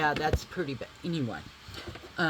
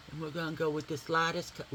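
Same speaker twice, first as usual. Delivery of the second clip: usual speaking voice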